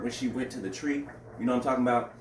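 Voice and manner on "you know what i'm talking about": ordinary delivery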